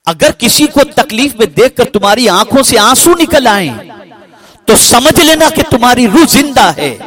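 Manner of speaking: high, loud preaching cry; impassioned preaching. Acted. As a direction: lift the line into a fervent sermon peak. Preacher builds to full force. (پرجوش خطیبانہ انداز)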